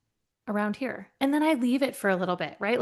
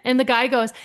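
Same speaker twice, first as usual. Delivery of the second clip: usual speaking voice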